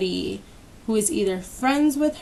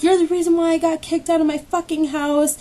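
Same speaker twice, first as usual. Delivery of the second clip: higher, imitated angry accusation; mock rant in a raised pitch; retold screaming; 6 semitones higher than usual. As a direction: hurl blame as the furious woman. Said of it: "she just kept screaming at me".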